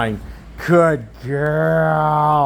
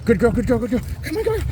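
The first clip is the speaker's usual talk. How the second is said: excited dog praise; 6 semitones higher, faster than usual. Real, not acted. Urging the dog on.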